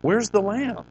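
high, puzzled son's question. Acted. Son asks his father where the lamb is, pitched high. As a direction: lift it up high like a boy asking.

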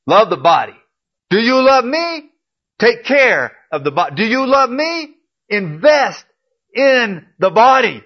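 high, loud, driving sermon cadence; full-force preaching. Acted. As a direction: hammer each call home to the church.

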